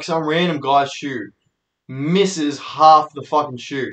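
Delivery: ordinary talk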